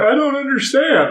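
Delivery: high-pitched mock bewilderment; exaggerated high confused whine; confused friend.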